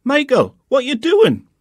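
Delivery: high, loud call across the garden; mother calling out sharply; mum shouting. A narrator's reading. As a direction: shout his name from the back door. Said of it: "I heard Mum shouting at me".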